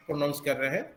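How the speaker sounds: ordinary talk, nothing marked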